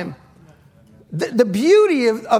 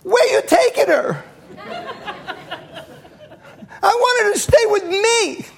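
mock protesting dad; 8 semitones higher than usual. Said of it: "and I go".

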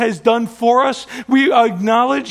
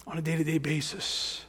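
much softer, lower, with a rising end; soft questioning echo; 6 semitones lower, 15 dB quieter than usual. Shown as a demonstration. Preacher asking the congregation softly. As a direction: drop to a near hush and lift the last word. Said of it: "How many need God's mercy".

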